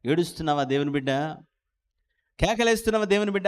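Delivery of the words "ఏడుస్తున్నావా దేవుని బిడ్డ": usual speaking voice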